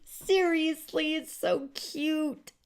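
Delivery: slow tender so cute. Real, not acted. Gushing over the card.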